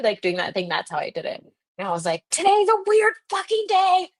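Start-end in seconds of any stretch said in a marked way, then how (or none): 2.3-4.1 squeaky, way-up-high mock exclamation; high-pitched disbelief. Retelling her astonished reaction.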